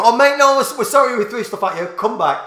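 high, loud, pleading call; hollered sorry from an upstairs window; mock apologetic shout. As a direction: imitate calling someone back with fake apology. Acted calling back from upstairs window.

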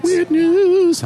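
high, loud game-show squeal of the title; mock excited. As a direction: shriek the title like an overexcited contestant. Echoing the segment name.

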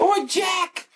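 very high mock Australian cry; muddled Australian accent. Rose calling Jack, very high botched Australian accent. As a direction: squeal it in a bad mixed Australian-English accent. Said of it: "like a Boston, English, Australian, all mixed in one".

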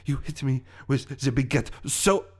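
French villain recalling torment, with long pauses; French accent. Acted. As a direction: lament haltingly in a French accent. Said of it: "my French accent".

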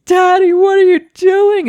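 very high, loud mock shriek; imitated screaming. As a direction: mimic horrified kids screaming at dad. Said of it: "they're screaming".

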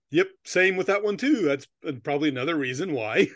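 usual speaking voice

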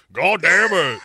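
put-on loud gruff old-man curse. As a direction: bark it like a cranky old man. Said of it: "Instead of, like, a [name] Yaya".

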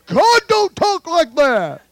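slow, high mock protest; mocking objector. Preacher imitates a doubter of God's words, mocking.

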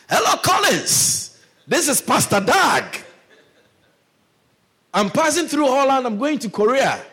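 chirpy pastor leaving a voicemail; hammed-up answering machine greeting; pastor's phone message.

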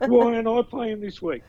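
imitating a whingeing player, high and loud; mock a player's tantrum, pitched right up. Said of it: "I chucked a little tantrum".

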